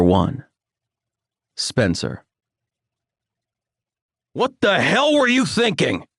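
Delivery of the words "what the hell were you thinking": high, furious father voice; angry father. A narrator's reading. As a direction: scold him as an enraged father. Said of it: "my father's lecture".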